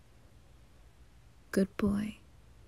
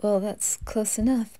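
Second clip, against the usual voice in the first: ordinary talk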